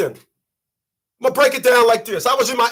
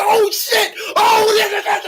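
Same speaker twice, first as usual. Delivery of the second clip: excited stammer; 11 semitones higher than usual. Retold or imitated. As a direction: sputter like a fan seeing his dream car. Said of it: "He's getting excited".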